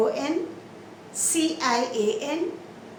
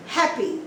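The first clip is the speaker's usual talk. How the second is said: happy with HAP strong, py weak; stress on first syllable; 3 semitones higher than usual. Shown as a demonstration. Teacher shows learners first-syllable stress, stress on HAP in happy. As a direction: punch the HAP in happy, let py drop. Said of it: "You stress on the first syllable".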